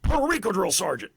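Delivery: high, loud mock military reply; recruit's shouted answer. Recruit answers the drill sergeant at inspection, high shouted reply. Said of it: "So I said".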